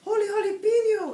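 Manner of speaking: very high, loud kitten cry; excited exclamation. A narrator's reading. Kitten finds a bird in his ear, high loud exclamation. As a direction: squeal it out in amazement. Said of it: "exclaimed Skippy John Jones".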